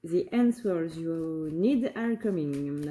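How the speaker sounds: slow, careful English with a French accent; joking French-accented English read aloud. Shown as a demonstration. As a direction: spell out the English slowly in a self-mocking French accent. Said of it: "Avec mon superbe accent anglais".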